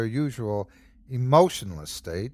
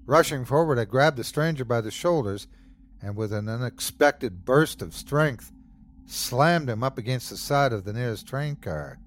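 ordinary delivery

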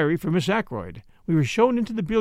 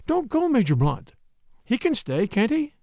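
high, anxious plea; nervous; 7 semitones higher than usual. A narrator's reading. Young woman asking for the Major to stay, nervous. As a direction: appeal uneasily in a raised voice. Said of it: "asked Flora nervously".

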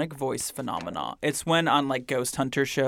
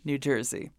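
normal talking voice

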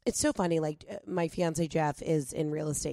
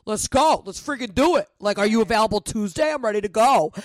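eager New York go-getter; 3 semitones higher, 6 dB louder than usual. Retold or imitated. Imitating pushy New York businesspeople.

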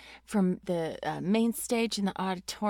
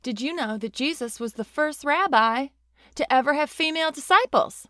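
louder, emphatic sermon delivery; preaching pastor; 6 semitones higher, 5 dB louder than usual. Retold or imitated. Quoting the senior pastor's sermon, preaching. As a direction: build to a big, higher preacher's punch. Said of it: "he was preaching about how he was like".

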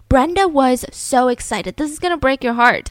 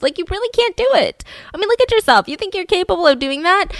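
high-pitched, scornful put-down; acted-out dismissive challenge; belittling; 7 semitones higher, faster than usual. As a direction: talk down to them in disbelief, pitch jumping up. Boyfriend belittles her in story.

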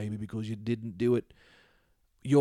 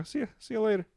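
usual speaking voice

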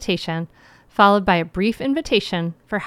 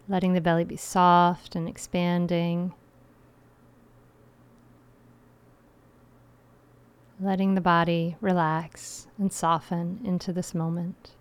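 guided meditation; 4 dB quieter than usual. Acted.

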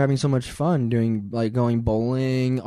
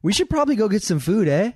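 higher, teasing Canadian-style eh; mock Canadian eh; 7 semitones higher than usual. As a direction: tease her Canadian "eh". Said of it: "She's like".

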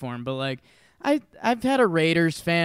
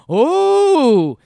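crowd gasp; 12 semitones higher, 10 dB louder than usual. Retold or imitated. Crowd reacting to up-and-in pitch.

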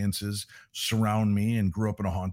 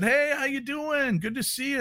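cheery greeting, retold. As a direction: re-enact a neighborly greeting in a much higher voice. Acting out greeting to neighbor.

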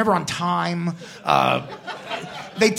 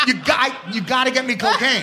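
retold freak-out; 6 semitones higher than usual. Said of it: "I freaked out".

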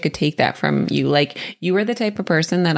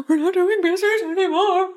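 imagined outburst. Retold or imitated. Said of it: "I would have been like".

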